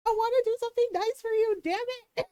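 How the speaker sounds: high, fast playful protest; mock frustrated. Insisting on a gift for her partner, fast high protest. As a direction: rush it up high in fond frustration. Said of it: "And I'm like".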